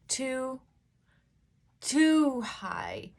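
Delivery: normal talking voice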